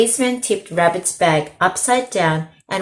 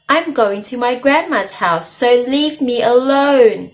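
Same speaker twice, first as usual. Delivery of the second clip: yelled; 5 semitones higher, 5 dB louder than usual. A narrator's reading. Girl yelling at the wolf to leave her alone. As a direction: yell to drive him off. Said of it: "she yelled".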